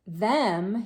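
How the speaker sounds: usual speaking voice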